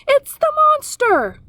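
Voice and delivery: high, loud alarmed screech. A narrator's reading. Owl screeching at the robot in alarm. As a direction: shriek the warning.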